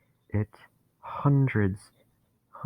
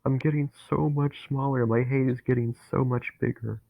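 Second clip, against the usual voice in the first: higher, louder, panicked voice; rising dread; 4 semitones higher, 3 dB louder than usual. Acted. Dennis feeling hate take him over, panicking. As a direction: feel the bitterness swelling, alarmed.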